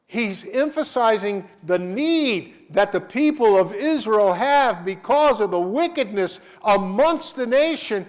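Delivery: high, loud fervent preaching. Real, not acted. Preacher pressing the congregation, fervent and loud. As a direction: drive the point home to the church.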